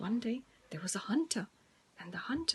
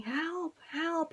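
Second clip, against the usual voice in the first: mock cry for help; 6 semitones higher than usual. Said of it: "He was saying".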